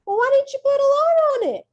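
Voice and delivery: high, loud complaining colleague; whiny teacher. Retold or imitated. Disappointed colleague complains to Miss Wofford, high and loud. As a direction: whine it like a let-down coworker. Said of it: "they're like".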